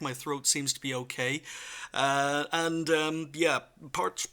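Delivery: ordinary talk, nothing marked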